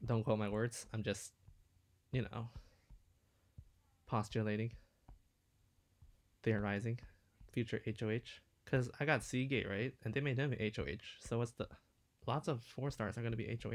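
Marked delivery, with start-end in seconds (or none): none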